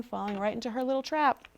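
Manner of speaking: plain reading voice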